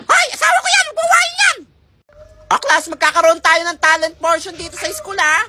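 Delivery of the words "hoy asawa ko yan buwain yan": high, loud squeal; possessive fangirl. Acted. Girl fights classmate over her crush, squealing loudly. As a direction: cry out high and jealous.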